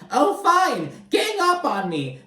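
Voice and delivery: hammed-up poor-me whine; mocking whiny Tamara. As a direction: squeak it out like a sulking child. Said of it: "And Tamara's like".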